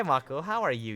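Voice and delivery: ordinary talk, nothing marked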